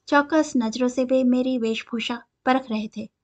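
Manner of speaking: usual speaking voice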